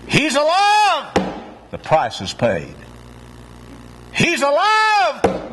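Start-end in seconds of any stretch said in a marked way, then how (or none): 0.1-1.1 slow, high, ringing proclamation; proclaimed. Acted. Preacher proclaims the resurrection to the congregation, high and ringing. 4.1-5.5 slow, high, ringing proclamation; proclaimed. Acted. Preacher proclaims the resurrection to the congregation, high and ringing.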